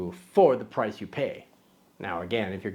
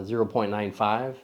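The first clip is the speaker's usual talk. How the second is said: ordinary delivery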